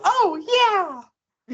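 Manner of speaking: high, gleeful "oh yeah"; excited whoop. Acted. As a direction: whoop like a happy giant. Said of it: "you just go".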